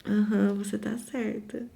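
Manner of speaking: soft, submissive murmur; yielding softly to the angry woman; meek agreement. Retold or imitated. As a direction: give in quietly to keep the peace. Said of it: "E aí eu só fiz assim".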